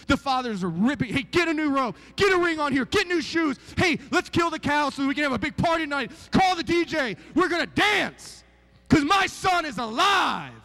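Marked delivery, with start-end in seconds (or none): 1.1-10.5 loud, high, jubilant calls; joyful father. Acted. Father orders robe, ring and party for his son, jubilant shout. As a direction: shout it out like the best news ever.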